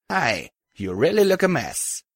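breezy and cheerful over carnage; chirpy Rampage. Acted. Rampage greets the wounded narrator.

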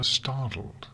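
ordinary delivery

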